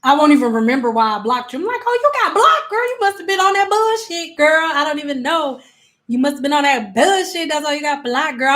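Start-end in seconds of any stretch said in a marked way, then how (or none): 1.8-8.5 high-pitched, teasing call-out; sassy mock realization. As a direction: tease a friend, starting way up high. Acted teasing of the blocked person.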